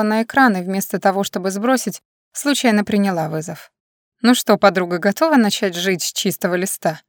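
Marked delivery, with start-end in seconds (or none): none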